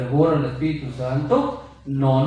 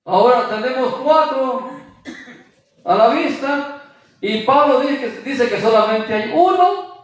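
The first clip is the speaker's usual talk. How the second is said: preaching, rising; 6 semitones higher, 3 dB louder than usual. Acted. Preacher rises to make his point.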